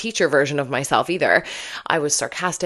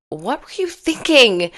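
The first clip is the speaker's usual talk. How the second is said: put-on high, loud exasperated yell at the kids; the yell avoided; 8 semitones higher, 6 dB louder than usual. Parent yelling at kids over a peach pit in a shoe. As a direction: snap it out like a fed-up parent. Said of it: "instead of yelling".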